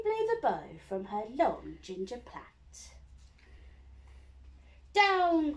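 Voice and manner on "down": higher, louder falling command; witch's cry. A narrator's reading. Witch ordering the broom down, loud cry. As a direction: shout the broom down. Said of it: "cried the witch".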